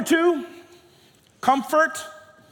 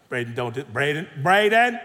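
emphatic parent; 6 semitones lower than usual. Acted. Parent warning a child named Braden, increasingly emphatic. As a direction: repeat the name with mounting insistence. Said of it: "parents that are emphatic with you".

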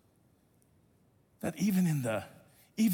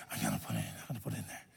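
much lower and softer mutter; Hugo muttering; 13 dB quieter, much faster than usual. Retold or imitated. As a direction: mumble it under your breath, sneaking money in. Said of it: "his voice is like this".

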